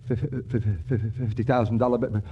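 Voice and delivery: stuttering in shock; gasping stammer at the cash; stunned stammer. Acted. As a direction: trip over the words in disbelief. Stammering at sight of money.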